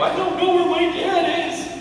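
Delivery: put-on high, small child's voice, close to tears; lost little boy. Preacher voicing himself as a boy lost in the mall, high and childlike. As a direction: squeak it out like a scared lost kid. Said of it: "And I go".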